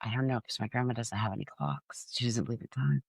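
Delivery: soft, hushed imitation of whispering; retold whisper. Whispering to a friend so grandma won't hear, hushed mock whisper. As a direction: drop it softly, as if into someone's ear. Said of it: "And I whispered in this person's ear".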